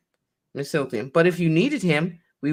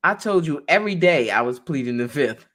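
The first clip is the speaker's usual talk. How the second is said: normal talking voice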